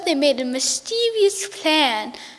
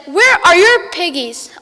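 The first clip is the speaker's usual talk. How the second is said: witch demand; 4 semitones higher, 8 dB louder than usual. Acted.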